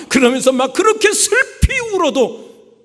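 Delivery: recalling the tantrum, loud and strained; loud wailing retelling. Retold or imitated. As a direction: cry out 그렇게 슬피 like the boy did. Retelling crying at market.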